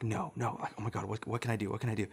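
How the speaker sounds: softly voiced, fretful self-talk; mild muttered panic. Seventh grader caught making his brother cry, hushed panic. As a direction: mutter it as anxious thoughts.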